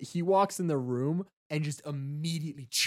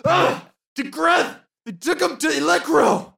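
high, loud agonized outburst; strained cry of a stabbed prisoner; grunting in pain; 12 semitones higher, 7 dB louder than usual. Acted. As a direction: blurt it out through the pain. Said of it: "is grunting in pain".

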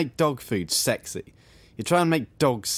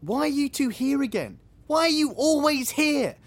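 higher-pitched exasperated complaint; 12 semitones higher than usual. Acted. Resident finding two insane women in the living room, exasperated. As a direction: complain as if utterly fed up with them. Resident annoyed at unwanted visitors.

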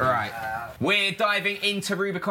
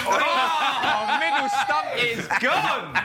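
high, laughing cry of disbelief; delighted laughing exclamation; 9 semitones higher, much slower than usual. Real, not acted. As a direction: laugh it out, stumps gone. Bowling machine knocks stump.

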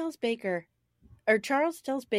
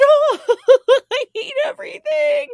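high, loud hammed-up sobbing; mock hardcore crying; 11 dB louder than usual. Imitating Ma sobbing in bed like herself last week, mock wailing. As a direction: wail it in exaggerated misery. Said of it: "Just, like, hardcore crying".

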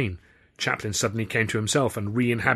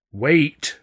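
sharp raised call; urgent call; 5 semitones higher, 8 dB louder than usual. A narrator's reading. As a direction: call out suddenly. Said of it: "I said again".

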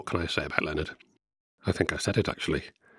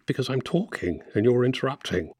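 annoyed; 6 semitones higher, 3 dB louder than usual. Real, not acted. Scolding interrupting brother.

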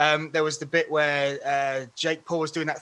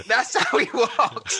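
higher, slower, laughing through the words; laughing quote; 6 semitones higher, slower than usual. Retold or imitated. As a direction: chuckle out the line in disbelief. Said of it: "You went".

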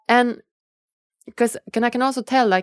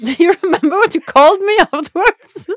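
laughing; 10 semitones higher, 6 dB louder, faster than usual. Real, not acted. Laughing recalling nickname.

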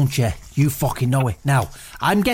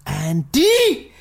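drawn-out mystical call of the name Andy, a bit higher; stage psychic calling Andy from the beyond; 4 semitones higher than usual. Pretend. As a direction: summon the name like a stage medium, long and sung.

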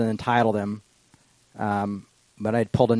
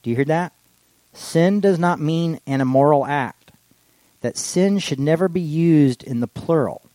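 preaching; 5 semitones higher, 6 dB louder, slower than usual. Acted. Preaching at full force.

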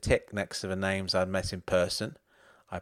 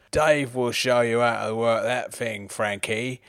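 louder pub-dad yell across the room; dad shouting to his mates across the bookies; 5 dB louder than usual. Acted. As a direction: bellow it teasingly over the noise. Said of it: "yelled at the top of his voice".

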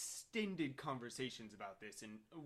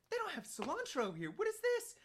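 retold incredulous complaint, climbing higher; exasperated disbelief; 4 dB louder than usual. Complaining to wife in the shop, incredulous. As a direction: voice shooting up high in mock outrage.

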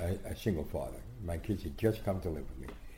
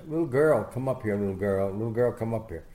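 mock summons; 2 semitones higher, 5 dB louder than usual. Boxer calling a shy little girl over, mock commanding. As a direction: call out commandingly, imitating the champ.

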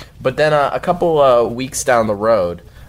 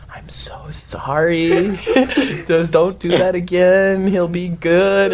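high, slow, drawn-out apology; imitating mom, singsong and high; pleading mom; 8 semitones higher, slower than usual. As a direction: coo an apology to a ghost, stretched out. Mom heard apologizing to ghost.

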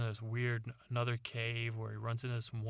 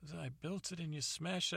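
higher, hurt father complaining; dad voice; 7 semitones higher than usual. Retold or imitated. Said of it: "and he said".

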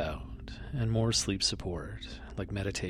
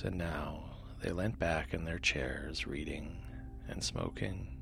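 soft, low bedtime reading; sleep story narrator; 4 semitones lower, 4 dB quieter than usual. A narrator's reading. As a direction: read gently to lull listeners to sleep.

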